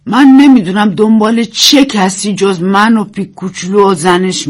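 bitter complaint. A narrator's reading. Old woman addressing the searching planes, loud bitter accusation. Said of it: "پیرزن زیر لب گفت".

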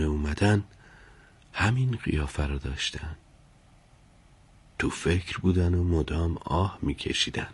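quiet, wondering inner monologue; talking to oneself. A narrator's reading. Servant recalling his master's pensive look, softly talking to himself. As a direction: murmur it privately, puzzling it over. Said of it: "با خودش گفت".

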